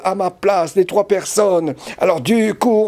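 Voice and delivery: ordinary talk, nothing marked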